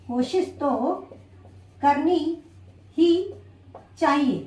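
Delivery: spaced out word by word, pausing between each; slow dictation. Shown as a demonstration. Teacher writing the example on the board, dictating word by word. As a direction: dictate it slowly, one word at a time as you write it. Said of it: "देखा आपने यहाँ पे मैंने sentence को करनी ही चाहिए".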